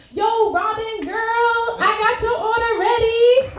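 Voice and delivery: high singsong imitation of the guy at the counter; playful high-pitched caller voice; mimicking Brian. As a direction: sing it out high like a flirty cashier. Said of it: "Brian's like".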